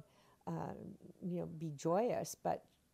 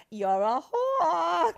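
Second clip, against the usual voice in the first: put-on high, loud, slow drunken snarl; drunken rage line; 11 semitones higher, 12 dB louder, much slower than usual. Actress replaying her drunken stage line, loud and slow. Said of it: "one of my favorite lines I had".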